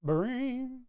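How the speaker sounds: high cartoon spring boing; Mario boing. Recalling the Mario game sound, high springy boing. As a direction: bounce it out like a video game spring. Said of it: "That would be the Mario sixty-four noise".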